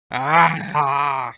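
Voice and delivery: short, loud frustrated cry; frustrated yell. Retold or imitated. O'Brien throwing the wrench down, loud frustrated cry. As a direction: let out a burst of rage.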